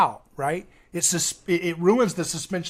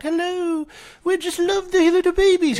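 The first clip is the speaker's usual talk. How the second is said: much higher, sugary mock politician voice; polished politician; 12 semitones higher, 4 dB louder than usual. Mocking a polished politician's baby-loving speech. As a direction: parody a clean, politically correct speech. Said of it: "this polished little clean, politically correct".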